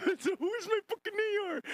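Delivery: high squealing impression; mock high yell. Streamer imitating the clip guy, high squeal.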